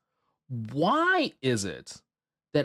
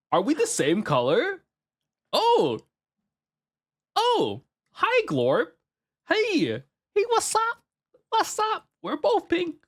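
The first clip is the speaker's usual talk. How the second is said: very high squeaky greeting; squeaky cutesy voice; slower than usual. Pretend. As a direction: squeal hello like a tiny mascot.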